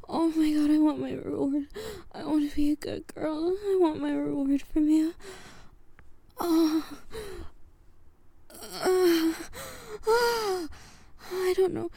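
loud pleading. Real, not acted. Pleading loudly.

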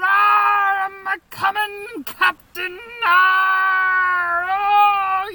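ecstatic shouting. Acted. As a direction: let it all out at the peak. Climax shouting.

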